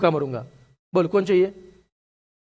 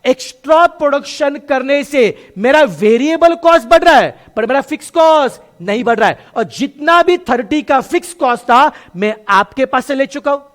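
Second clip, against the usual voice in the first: emphatic teaching; 6 semitones higher, 7 dB louder than usual. Real, not acted. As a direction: drive the point home to the class. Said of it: "teacher stressing conclusion".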